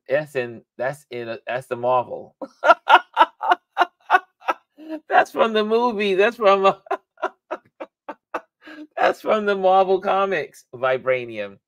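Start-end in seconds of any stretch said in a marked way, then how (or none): 2.4-3.9 high burst of laughter; laughing at the mix-up; big laugh. Real, not acted. Laughing at own mistake. 5.1-10.6 laughing. Real, not acted. Laughing at own mistake.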